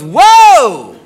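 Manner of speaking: mock-alarmed high, loud whoa; startled whoa. Girlfriend stops boyfriend putting on unwashed underwear, startled. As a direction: stop him with a long, loud "whoa".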